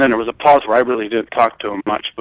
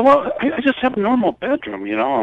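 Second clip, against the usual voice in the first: sarcastic; 12 semitones higher than usual. Retold or imitated. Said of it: "I was kinda sarcastic about it".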